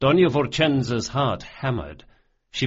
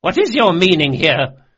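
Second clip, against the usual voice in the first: high, loud, indignant challenge; angry demand; 7 semitones higher, 9 dB louder than usual. A narrator's reading. Old noblewoman demanding why intruders came, angrily.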